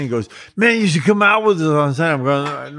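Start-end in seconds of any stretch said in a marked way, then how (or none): 0.6-2.1 higher-pitched, lightly acted eager invite; eager invitation. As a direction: pitch it up, urging a friend along. Acting out eager colleague's invitation.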